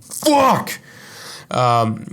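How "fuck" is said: dismayed fuck. Retold or imitated. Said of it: "I was like".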